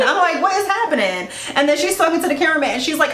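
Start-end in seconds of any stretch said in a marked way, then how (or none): 0.4-1.3 high-pitched disbelief, retold; acted bewilderment, pitched well up; baffled reaction. Viewer watching a bride walk off, acting baffled.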